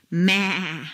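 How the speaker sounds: maa bleated like a goat. Shown as a demonstration. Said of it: "sound that a goat makes".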